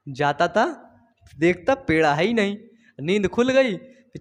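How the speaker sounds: ordinary delivery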